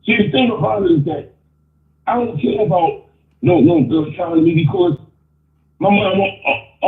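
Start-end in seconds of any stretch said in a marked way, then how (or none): none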